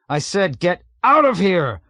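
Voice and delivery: high, loud shout near tears; tearful shout. A narrator's reading. Pilot shouts tearfully at navigator. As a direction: shout it as you break down. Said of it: "Yossarian shouted and broke into tears".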